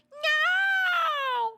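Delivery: very high, loud drawn-out wail; cute coworker squeal. Retold or imitated. Streamer acting out cute coworkers, high drawn-out squeal. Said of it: "her cute kind of coworkers".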